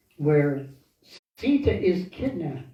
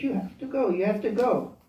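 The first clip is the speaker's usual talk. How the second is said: raised pitch, pressing him to go; insistent urging; 5 semitones higher than usual. Acted. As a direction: push him harder with each phrase. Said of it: "keeps pushing him".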